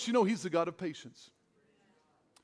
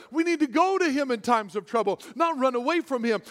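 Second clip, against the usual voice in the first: usual speaking voice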